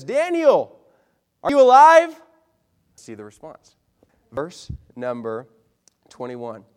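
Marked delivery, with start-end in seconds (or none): 0.0-2.2 loud, high shout into the den; anxious call. Retold or imitated. King shouting down to Daniel in the den, anxious. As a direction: yell down the pit, desperate. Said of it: "and he says".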